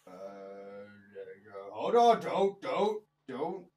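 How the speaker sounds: low gruff giant, irritated; grumpy cyclops. Acted.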